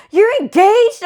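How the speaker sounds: high, loud imitation of a thrilled squeal; excited gossip. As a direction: announce it like thrilling news, hammed up. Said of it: "this lady was so excited".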